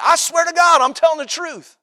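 high-pitched, imitated protest of honesty; insistent oath. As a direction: plead to be believed, hammed up. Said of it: "You ever heard somebody say that?".

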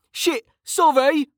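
very high, drawn-out yelp; shouted apology. A narrator's reading. Mage who just hit an officer with a spell, shouted apology. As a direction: shout sorry in a high, slow call. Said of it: "Kettle shouted".

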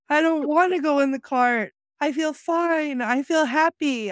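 imitating the man on the cart's shoulder, mock protest. Quoting the man who's alive on the dead cart, mock protest. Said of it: "he's saying things like".

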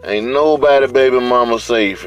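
ordinary talk

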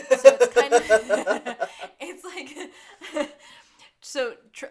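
bursting into laughter mid-sentence; loud real laugh breaking the words. Real, not acted. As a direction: lose it laughing. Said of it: "laughs while explaining".

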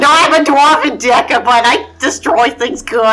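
loud, high brassy dwarf; brash dwarf decker voice; character voice. Acted. Player introduces her dwarf character.

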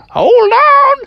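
very high, loud squawk; ostrich warning shout. Acted. Ostriches warn riders before bucking, high shout. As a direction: yell a warning like a cartoon bird. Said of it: "the ostriches just said".

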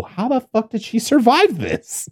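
high, baffled disbelief; incredulous. Retold or imitated. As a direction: ask it in total disbelief. I still go.

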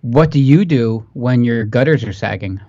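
ordinary talk, nothing marked

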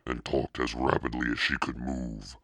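usual speaking voice

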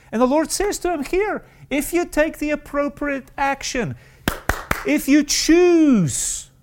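high, loud sermon climax, slowed on key words; fervent pulpit exhortation; impassioned preaching. Acted. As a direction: preach it, urging the congregation to choose. Preaching climax on choosing well.

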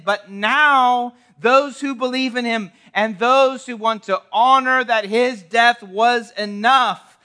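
loud, driving sermon climax; preaching at full force. Acted.

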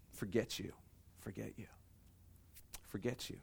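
low, dismissive muttered rejection; hushed, cold brush-off; quiet defiance. Acted. Mankind turning its back on God, quietly defiant. Humanity's rebellion voiced to God.

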